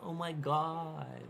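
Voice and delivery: a mock Tennessee accent, drawn out; Southern American accent. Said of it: "They're like".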